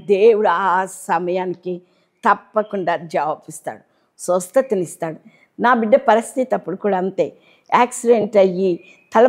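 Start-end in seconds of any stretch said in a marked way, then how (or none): none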